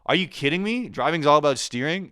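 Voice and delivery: high, loud, mock-astonished; sarcastic fake amazement. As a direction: act blown away to mock the obvious.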